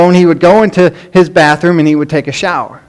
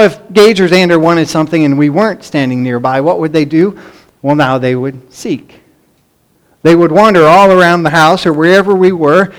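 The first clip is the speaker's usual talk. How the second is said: ordinary talk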